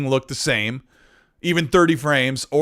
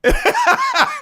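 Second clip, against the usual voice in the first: high burst of laughter. Real, not acted. Joke about Kojima dating.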